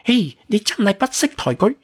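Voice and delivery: put-on high-pitched girlish outburst; indignant. A narrator's reading. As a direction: scold him in a high, huffy voice. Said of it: "激到面都紅咗". (高音女仔聲發脾氣)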